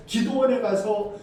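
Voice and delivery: ordinary delivery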